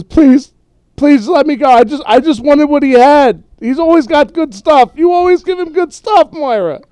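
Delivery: high, loud desperate begging; pleading. Acted. David begs the fighters to let him go, high and loud. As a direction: beg for your life. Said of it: "David looks at both of you and says".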